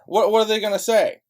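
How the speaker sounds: furious rhetorical shout; angry shout. Real, not acted. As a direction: yell the question out in frustration. Ranting at the audience.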